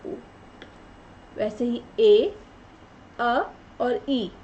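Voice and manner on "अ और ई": अ और ई each held long; slow vowel demo. Shown as a demonstration. Teacher showing how ए is built, slowly. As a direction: stretch अ and ई apart clearly. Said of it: "अ और ई को मिला के बनता है ए".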